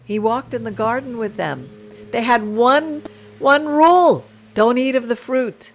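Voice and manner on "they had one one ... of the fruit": rising, louder preacher's emphasis; building sermon emphasis; emphatic preaching. Real, not acted. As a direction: drive the point home with force.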